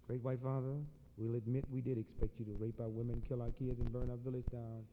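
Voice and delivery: low, soft grave accusation; quiet, measured accusation; solemn low indictment. Retold or imitated. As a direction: drop it low and heavy. Red man's grave indictment.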